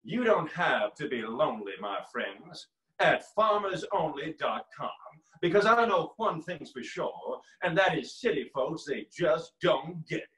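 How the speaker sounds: slow, creepy character impression; Frank Underwood. Pitching a farmers' dating site, in a slow creepy voice. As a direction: deliver it ominously, drawing out the phrases. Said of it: "don't use the voice of Frank Underwood".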